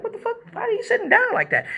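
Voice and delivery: very high, baffled mock voice; confused women imitated. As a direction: mimic bewildered women in a high squeal. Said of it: "you see the women get confused too".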